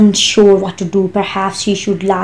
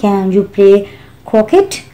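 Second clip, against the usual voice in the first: usual speaking voice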